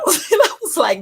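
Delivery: giggling through the retelling; laughing. Real, not acted. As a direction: crack up while telling it. Laughing at her own mistake.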